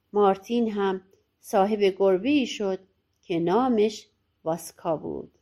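usual speaking voice

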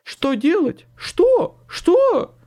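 loud squeal climbing very high; panicking. A narrator's reading. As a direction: lose your head completely. Second man panics, repeats himself.

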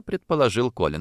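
ordinary delivery, nothing marked

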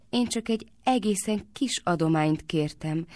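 ordinary delivery, nothing marked

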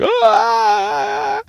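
very high, loud mock scream; one long shrieking cry, hammed-up; imitated screaming. People screaming in the bathroom during a shooting, mock scream. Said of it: "some people are crying and screaming".

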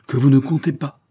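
outraged, throwing her will at him; indignant cry. A narrator's reading. Wife defying the marquis, crying out in indignation. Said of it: "s'écria-t-elle".

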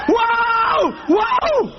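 high, drawn-out wail of alarm; acted alarm. Passenger hearing the plane is falling, hammed-up wail.

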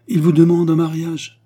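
loud, high-pitched declaration; emphatic announcement. A narrator's reading. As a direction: drive the news home forcefully. Queen answers amazed Andrée.

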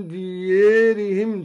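ديارهم said close to "diyeerihim"; inclined alef. Shown as a demonstration. Teacher demonstrating al-Duri's reading, ديارهم with a fronted long vowel. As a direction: tilt the long a of ديارهم toward e. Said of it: "وكذلك ديارهم في الآية التالية".

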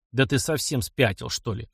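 plain reading voice

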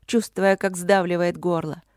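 ordinary delivery

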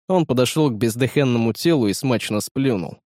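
ordinary delivery

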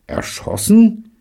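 hoch und laut, ungläubig gerufen; begeisterter Ausruf. A narrator's reading. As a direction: ruf es entgeistert und begeistert zugleich. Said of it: "rief Michael, noch ganz begeistert". (Michael, begeistert von Davids Bericht, ruft ungläubig)

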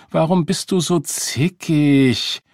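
high, loud, drawn-out whine; bratty complaint. A narrator's reading. Girl needling her mother, bratty and exasperated.

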